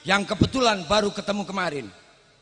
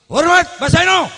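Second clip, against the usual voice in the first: high, loud call of honour; loud salute; 7 semitones higher, 9 dB louder than usual. Real, not acted. Orator salutes elder before crowd.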